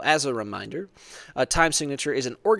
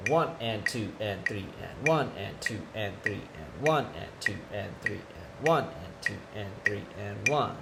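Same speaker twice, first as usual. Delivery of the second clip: eighth note count; 4 semitones lower, 6 dB quieter than usual. Shown as a demonstration. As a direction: count one and two and, evenly and softer.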